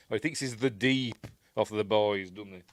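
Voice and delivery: ordinary delivery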